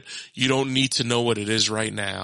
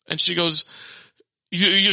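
usual speaking voice

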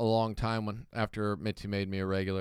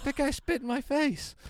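indignant protest. Retold or imitated.

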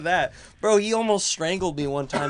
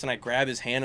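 ordinary delivery

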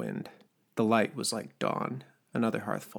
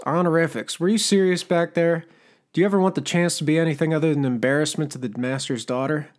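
higher, louder, fast scolding; angry berating; 6 semitones higher, 8 dB louder than usual. Acted. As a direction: tear into him in exasperation. Smoker berates Turner angrily.

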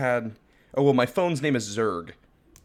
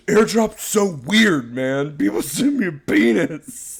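slow, higher, louder put-upon rant; mock indignant coworker; 5 semitones higher, 7 dB louder, slower than usual. Coworker complaining about the airdropped picture, hammed-up outrage. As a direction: act out a friend fuming and drawn-out. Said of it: "And he was like".

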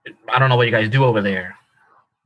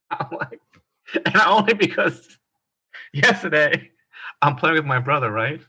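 ordinary talk

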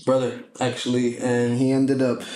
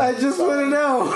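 high, raised-voice plea; pitched way up, pleading innocence; insistent protest; 13 semitones higher than usual. Real, not acted. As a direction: holler it in comic self-defense. Repeats it, insisting over the others.

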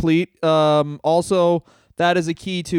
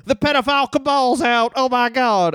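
high, aghast commentator call; mock wrestling announcer; 7 semitones higher than usual. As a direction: call the scandal like a ringside announcer.

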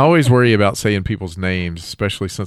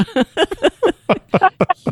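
high burst of laughter. Real, not acted. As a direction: let out a shrieking laugh. Big laugh after joke.

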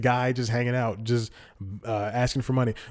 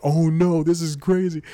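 higher and louder alarm; mock panic; 6 semitones higher, 6 dB louder than usual. Driver's gas pedal is stuck, a retold panic. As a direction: freak out behind the wheel.

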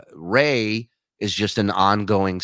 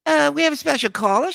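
much higher, fast mock host voice; imitating Rosie; faster than usual. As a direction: chirp it like a talk show host. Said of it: "She's like".

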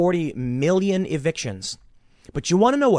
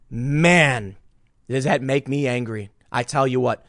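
ordinary talk

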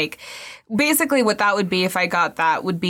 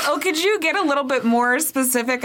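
lightly voiced, higher, pestering question; mock pushy asker; 6 semitones higher than usual. Clients pressing the psychic, mock pushy ask. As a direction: play the impatient client pressing for detail. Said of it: "people are like".

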